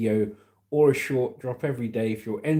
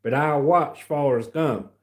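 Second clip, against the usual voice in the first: Alabama accent; 3 semitones higher than usual. Shown as a demonstration. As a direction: do a Forrest Gump-style Alabama accent. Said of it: "This is my Alabama accent".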